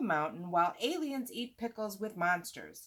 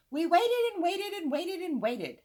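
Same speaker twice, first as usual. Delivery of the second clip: high, louder mock-dramatic storyteller; mock story voice; 10 semitones higher, 6 dB louder than usual. Boy mocking a dull story, high mock-dramatic voice. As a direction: ham up the endless waiting.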